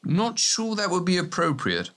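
high, hesitant protest; cautious, high-voiced doubt; cautious objection. A narrator's reading. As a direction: object carefully, pitched well up. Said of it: "Hegel opposed cautiously".

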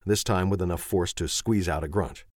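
usual speaking voice

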